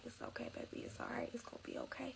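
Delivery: soft whispered reassurance; whispered comfort. Retold or imitated. The boyfriend soothing his pregnant girlfriend, whispered reassurance. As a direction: hush her tears, gently.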